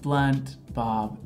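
normal talking voice

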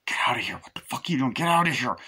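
hushed, urgent mock telling-off, rising at the end; urgent scolding. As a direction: shoo someone out without waking the sleeper. Acted telling-off beside sleeping woman.

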